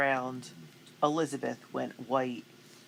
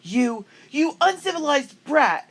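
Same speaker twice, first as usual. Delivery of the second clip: loud, high, slow sputtering insult; spoiled girl losing her temper; furious outburst; 13 semitones higher, 13 dB louder, slower than usual. A narrator's reading. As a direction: spit it at her in a rage. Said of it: "she glared at Felicity".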